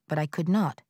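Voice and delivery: ordinary delivery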